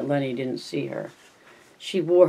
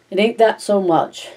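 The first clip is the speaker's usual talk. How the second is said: high-pitched acted misery; miserable exclamation; 7 semitones higher, 4 dB louder than usual. A narrator's reading. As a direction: blurt it out in distress. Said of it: "Lenny exclaimed miserably".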